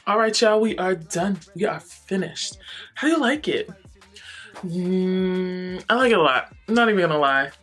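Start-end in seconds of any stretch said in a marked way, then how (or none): none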